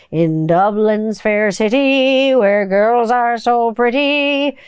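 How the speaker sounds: slow lilting Irish sing-song; leprechaun. Pretend. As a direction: croon it like a leprechaun. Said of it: "I sound like a leprechaun".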